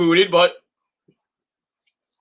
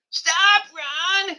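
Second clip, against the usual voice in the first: very high mock wail, less forceful; Sammy Sweetheart yell. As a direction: imitate a whining girlfriend calling out in falsetto. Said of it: "just to yell at Ron".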